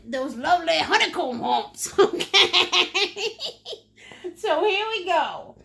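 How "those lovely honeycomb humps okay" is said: giggling through the words; laughing. Real, not acted. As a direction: laugh at your own phrase. Laughs at her own phrase.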